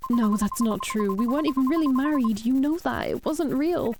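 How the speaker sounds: pleading that the marriage wasn't real; a widow's rising panic arguing with a ghost; frantic denial. Acted.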